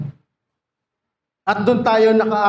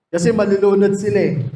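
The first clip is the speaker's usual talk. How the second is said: normal talking voice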